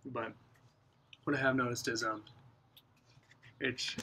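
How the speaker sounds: talking around pipe stem; pipe in mouth. Real, not acted. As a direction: lips clenched on pipe. Seen 2: Pipe stem clenched between lips throughout the labelled words.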